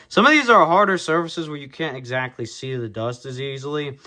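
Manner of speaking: ordinary talk, nothing marked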